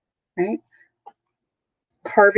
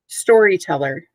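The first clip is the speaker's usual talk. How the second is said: ordinary talk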